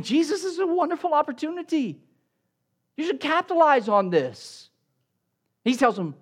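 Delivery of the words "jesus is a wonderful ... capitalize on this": high-pitched, mock-eager advice; eager urging. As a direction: pitch it high, like pushing a great opportunity. Voicing an objection urging Jesus to capitalize.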